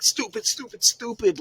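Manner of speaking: imitating her high-pitched fit; retold frustrated outburst. Imitating the wrestler berating herself after the match, high mock frustration. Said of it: "going like".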